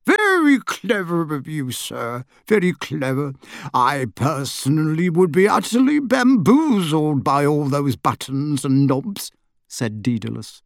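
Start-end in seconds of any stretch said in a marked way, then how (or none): 0.0-9.3 a little wizard bowing deeply; fussy little wizard. A narrator's reading. Daedalus flattering.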